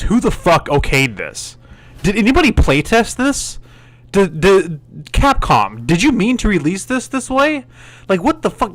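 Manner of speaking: fed-up reviewer railing at Capcom; exasperated rant. Real, not acted. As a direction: throw the question at Capcom in disbelief. Ranting at Capcom.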